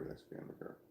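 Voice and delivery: whispered aside, under the breath. Real, not acted. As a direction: whisper it to yourself. Repeats the line in a whisper.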